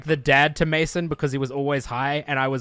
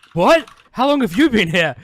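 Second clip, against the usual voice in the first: confused shock; 6 semitones higher, 5 dB louder than usual. Retold or imitated. Friend confused on plane.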